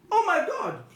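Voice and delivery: high, loud exclamation; sudden dramatic outburst in a poem; mock horrified cry. Poet reacts to the crash, high mock-shocked cry.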